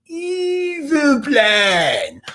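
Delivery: high, drawn-out, hammed-up "evil plan"; over-the-top cartoon evil cry; mock villain. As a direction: stretch it out with theatrical menace. Mock-villain repeat of the phrase.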